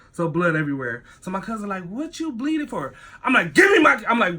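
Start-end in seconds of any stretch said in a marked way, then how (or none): 1.9-2.9 high-pitched mimicked question; weird-acting cousin. Cousin asks about the blood everywhere, high-pitched weird questioning. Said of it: "My cousin acting all weird". 3.5-4.0 sudden high, loud demand; angry yell. Retold or imitated. Speaker demands keys from cousin, angry high yell.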